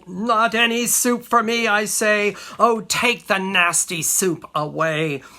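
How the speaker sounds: put-on loud, naughty child's protest; cried-out refusal. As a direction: cry out in stubborn refusal. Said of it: "the naughty fellow cries out still".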